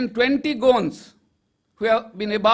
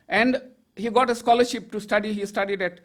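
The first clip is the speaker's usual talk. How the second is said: usual speaking voice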